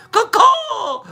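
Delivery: a high "Caw-caw" bird call; high imitated crow caw. Imitating a crow for Two Hawks.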